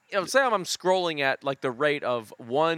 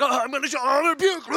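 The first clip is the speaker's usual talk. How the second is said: excited cat; 11 semitones higher, 4 dB louder, much faster than usual. Pretend. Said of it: "it's like".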